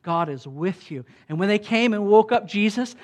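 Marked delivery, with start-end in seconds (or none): none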